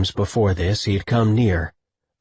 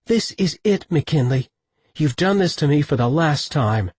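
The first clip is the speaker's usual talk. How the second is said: furious; 6 semitones higher than usual. A narrator's reading. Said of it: "She slapped his chest furiously".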